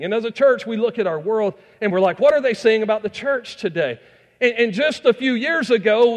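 high, loud pulpit preaching; full-force preaching. Acted. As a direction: preach it out to the whole congregation.

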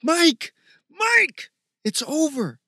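high, loud calls to a friend; insistent high-pitched yell; urgent shouting. Acted. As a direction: shout to snap him out of it. Friend calls hero out of rage.

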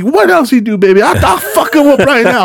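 high, fired-up egging on; hyping her up. Retold or imitated. Worker egging on caller while boss listens, hyped up. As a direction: act outraged for the caller, pitched high. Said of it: "I'm hyping her up".

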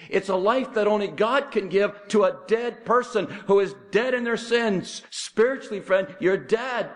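high, urgent pulpit delivery; high-pitched, emphatic preaching; preaching at full force. Acted. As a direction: press each phrase on the congregation like a revivalist.

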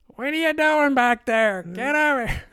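calling wife. Retold or imitated. Wife calls her husband away from the cyclist, high mock yell. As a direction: call your husband back sharply, hammed up.

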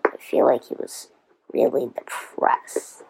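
whispered aside. Real, not acted. Host musing that Wilbur was depressed, whispering. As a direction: repeat the thought as a secret whisper.

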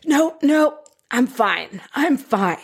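lightly acted insistence of being fine; mock holding it all in, starting high; tense denial. Someone clenching fists instead of crying, tensely in denial. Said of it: "you clench your fist and you".

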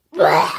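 loud low blech of disgust; disgusted by taste. Real, not acted. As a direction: spit out the blech in revulsion. After tasting seaweed.

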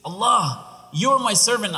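high, loud cry of joy; overjoyed. Acted. Rescued traveler muddles his thanks to Allah, overjoyed. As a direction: blurt it out, carried away by happiness. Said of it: "he's saying it out of happiness".

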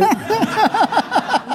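high-pitched giggle; quick high laugh. Real, not acted. Laughs before the impression.

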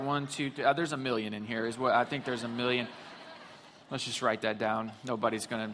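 low, offhand voice of a slapdash counter; lightly voiced, half-hearted tallying, kept quiet; careless counter. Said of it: "the guy that was like".

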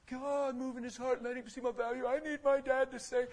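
hammed-up begging to God; mock desperate prayer. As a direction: parody a needy prayer. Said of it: "and I'm praying".

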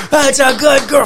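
excited praise from thirty feet away; calling across the room. Retold or imitated. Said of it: "from thirty feet away".

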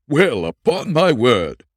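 loud, slow, startled cry of surprise; surprised exclamation. Acted. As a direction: blurt it out in shock, drawn out. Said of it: "exclaimed Jones".

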